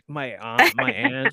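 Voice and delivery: normal talking voice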